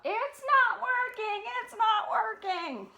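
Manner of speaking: high, slow wail of dismay; dismayed cry. Acted. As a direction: panic as it fails. Papa Bear after explosion.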